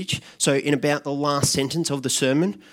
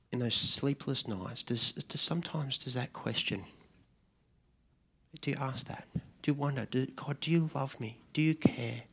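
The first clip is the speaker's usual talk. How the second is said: soft, hushed night prayer, fading to a whisper; much softer, ending in a whisper; 10 dB quieter than usual. Pretend. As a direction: pray it softly, as if alone at night. Quiet prayer in sleepless nights.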